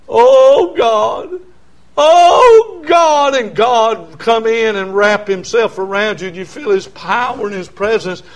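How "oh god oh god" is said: loud, high, drawn-out plea, building; impassioned acted altar cry; crying out to God. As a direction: cry it out louder and higher each time.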